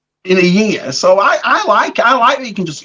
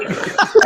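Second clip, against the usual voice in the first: short high breathy laugh; 11 semitones higher than usual. Real, not acted. Guest laughs at his own strawberries joke, short laugh. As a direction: chuckle at your own crude joke.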